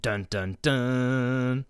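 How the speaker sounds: spoken dun-dun-dun, last one held; hammed-up cliffhanger sting; mock suspense sting. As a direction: imitate a dramatic reveal cue. Mimics dramatic reveal sting.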